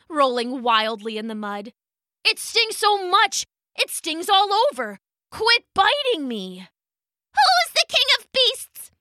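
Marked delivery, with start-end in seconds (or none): 2.2-6.7 pained whining. Acted. Lion stung all over. 7.3-8.9 very high tiny bug voice, taunting; buzzing gnat voice. Acted. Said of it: "the gnat buzzed".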